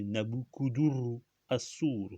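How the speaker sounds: "Nabukuduru Assur" sounded out slowly, syllable by syllable; slow, deliberate "Nabukuduru Assur"; careful pronunciation. Shown as a demonstration. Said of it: "le nom de Nabucodonosor".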